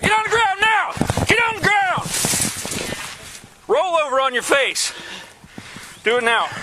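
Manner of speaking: high barked orders to get down; shouted surrender demands. Real, not acted. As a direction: yell the commands at a fleeing man. Officer ordering suspect down.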